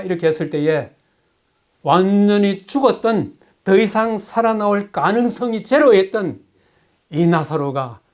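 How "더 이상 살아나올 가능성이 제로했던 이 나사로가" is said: normal talking voice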